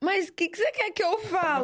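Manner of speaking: high, drawn-out exasperated plea; mock a husband at his wits' end, slowly; exasperação imitada. Wife mimics husband's exasperation in argument. (imitação aguda e arrastada de desespero)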